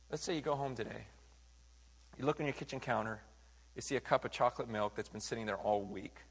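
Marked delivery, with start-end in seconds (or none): none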